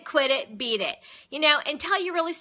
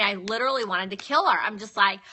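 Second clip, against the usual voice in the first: ordinary talk, nothing marked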